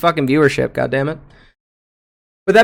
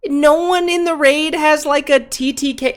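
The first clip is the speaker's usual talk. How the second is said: exasperated; 14 semitones higher, 4 dB louder than usual. Real, not acted. Ranting at guild.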